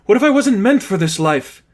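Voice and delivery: high, loud and tearful, gasping through it; a nun breaking down, pleading to be heard; sobbing nun. Acted. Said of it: "Sister Katya gasped for fresh breath".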